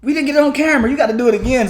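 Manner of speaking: high, loud hammed-up urging; excited urging. Telling the girl to drink again for the camera, loud excited. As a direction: hype her up to go again.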